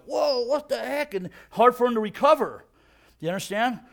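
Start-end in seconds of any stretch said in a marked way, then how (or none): 0.1-1.1 higher, drawn-out mock astonishment; lightly acted reeling surprise; dazed disbelief. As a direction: play a stunned boxer, slowly. Acting a dazed boxer's reaction.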